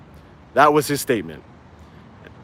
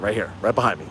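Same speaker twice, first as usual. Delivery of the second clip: normal talking voice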